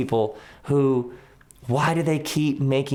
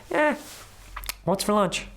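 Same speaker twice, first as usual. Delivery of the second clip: bored shrug; 9 semitones higher than usual. Retold or imitated. Indifferent listener mock voice.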